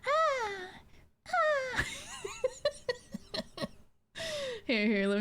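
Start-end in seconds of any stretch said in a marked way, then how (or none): 0.1-1.8 high, tiny squeaked moans; imitated turtle moan. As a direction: moan like a cute little turtle. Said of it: "cute little turtles and they're like".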